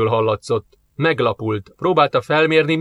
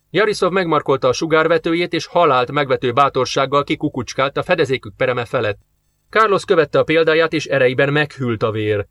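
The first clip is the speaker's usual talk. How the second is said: plain reading voice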